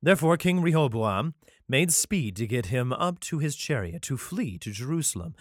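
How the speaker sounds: ordinary talk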